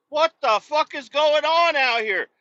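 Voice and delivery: high, loud outraged shout; real outraged shout; loud exclamation. Real, not acted. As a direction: yell it at the chaos outside. Shouting at street scene.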